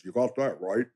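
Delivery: lightly imitating the president's easy reply; Barack Obama impression. Retelling the president's answer to Go blue, lightly voiced Obama. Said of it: "That's exactly how he said it".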